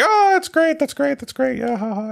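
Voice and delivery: little daughter. Retold or imitated. Dad imitating his daughter's reaction to his joke, high and gushing. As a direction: mimic a kid's over-the-top delight that trails off. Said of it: "And she's like".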